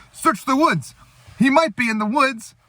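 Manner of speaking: high barking orders; soldier orders. Retold or imitated. Soldiers hunt the spy, high mock barked orders. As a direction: shout like a movie soldier.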